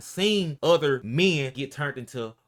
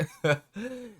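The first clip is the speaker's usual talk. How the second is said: high burst of laughter; amused laugh; 11 semitones higher than usual. Real, not acted. Laughing at the story.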